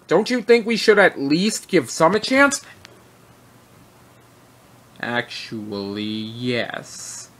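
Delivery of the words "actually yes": low dismissive. Acted. Boss flatly overrules employee.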